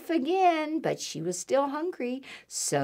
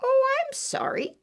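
very high rabbit voice; twittering rabbit. A narrator's reading. Rabbit turning Little Mouse away, high twitter. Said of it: "twittered Rabbit".

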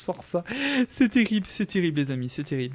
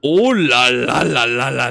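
loud, slow, drawn-out oh là là là; amazed oh là là; 5 semitones lower, 10 dB louder, slower than usual. Real, not acted. Gamer seeing his odd new armour, loud slow amazed exclamation. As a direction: stretch out the amazement at the sight. Sees the alien-looking armour.

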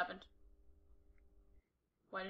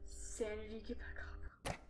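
soft unvoiced whisper; 12 dB quieter than usual. Real, not acted. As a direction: hush it under your breath. Whispering to himself in horror game.

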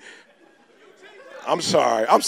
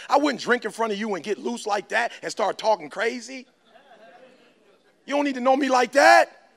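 ordinary talk, nothing marked

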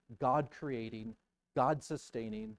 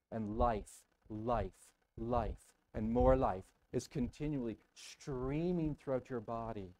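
ordinary talk

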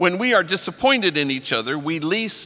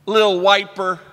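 louder, higher punchline; punchline reveal; 5 semitones higher, 6 dB louder, slower than usual. Retold or imitated. Revealing the misspelled tag name, loud punchline. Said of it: "instead of Little Whisper".

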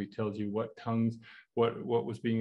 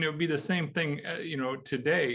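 ordinary talk, nothing marked

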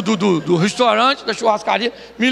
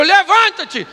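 raised, louder acted order; higher-pitched theatrical order; mock command; 7 semitones higher, 5 dB louder, slower than usual. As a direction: call it out like a command. Acted command to the lame man.

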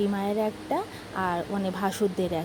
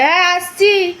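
high, loud reply across the house; calling back; 8 semitones higher, 10 dB louder than usual. Real, not acted. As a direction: shout it back to someone far off. Calling back from another room.

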